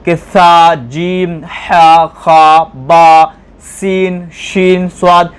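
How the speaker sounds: "सा" "जीम" "हा" "खा" "सीन" "शीन" "स्वाद" each articulated distinctly from the throat or teeth as in Quranic Arabic; careful letter pronunciation. Shown as a demonstration. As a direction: exact tajweed articulation of "सा", "हा", "स्वाद". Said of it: "तलफ़ुज़ की अदायगी".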